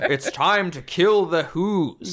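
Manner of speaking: loud, high, slow villain proclamation; hammed-up villainous battle cry; Grinch. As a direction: draw out each word like a gleeful cartoon villain. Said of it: "this Grinch thing happening".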